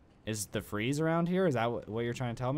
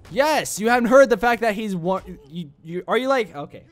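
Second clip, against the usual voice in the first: loud indignant outburst; angry; 7 semitones higher, 7 dB louder than usual. Real, not acted. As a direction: yell at the guard in outrage. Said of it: "I'm just so angry".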